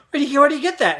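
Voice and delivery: high, stammered mock alarm; alarmed kid. Kid sees dad holding driftwood, high alarmed stammer. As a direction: stumble over it in comic panic.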